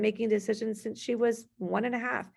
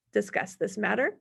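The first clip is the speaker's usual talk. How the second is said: ordinary talk, nothing marked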